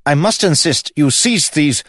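high, loud, insistent protest; warning guard. A narrator's reading. Guard protesting to the prince's brother, loud warning. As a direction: insist urgently until cut off. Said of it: "warned Vyse".

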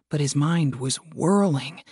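ordinary delivery, nothing marked

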